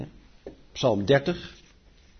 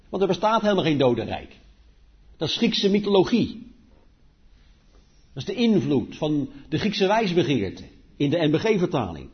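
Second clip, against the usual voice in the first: ordinary delivery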